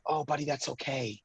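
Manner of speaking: hushed soothing "it's okay"; reassuring dad. Retold or imitated. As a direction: comfort a shy toddler. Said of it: "And I said".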